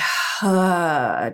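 long audible sigh out through the mouth; dramatic sigh. Shown as a demonstration. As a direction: let a breath go with a heavy sigh. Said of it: "release through your mouth with a sigh".

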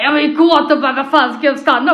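loud, indignant retold outburst; a hammed-up loud protest; mock shout. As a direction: blurt it out loud as if shocked. His reaction shouted in retelling.

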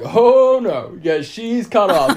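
loud, high "oh no", then slow and firm; mock bartender refusal. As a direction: imitate a bartender putting his foot down. Bartender refuses drink, retold.

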